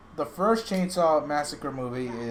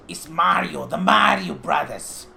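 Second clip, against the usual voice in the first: hammed-up Mario; 4 semitones higher, 7 dB louder, slower than usual. Mario voice excited announcement.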